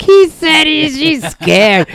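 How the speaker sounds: high, loud mocking voice; mimicking the lady. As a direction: tattle it back with a grin. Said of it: "you hear her going like".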